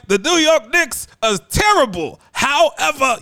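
mock hot-take yell, dragging it out; hammed-up TV pundit outrage, loud and high; Stephen A. Smith yelling. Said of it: "You think he's like".

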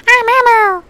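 very high, loud puppet squeal; Elmo. Acted. Retrying a failed puppet impression, high and loud. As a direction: badly imitate a children's puppet. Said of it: "I sound nothing like Elmo".

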